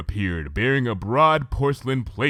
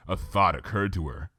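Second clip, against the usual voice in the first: ordinary delivery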